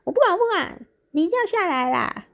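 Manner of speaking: hammed-up angry wife demanding he jump in; mock angry demand. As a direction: whine it like an upset wife insisting. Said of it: "然后媳妇就很生气啊".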